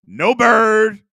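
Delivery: loud, high No Bird; emphatic shout. Real, not acted. Rejecting Pat's top five, loud shout.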